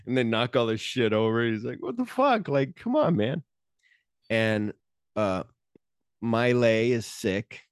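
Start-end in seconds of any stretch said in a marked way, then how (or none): 1.8-3.4 much higher, incredulous mock protest; high exasperated what-the-heck; outraged disbelief. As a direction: play the wronged vendor in disbelief. Character voiced after stall smashed.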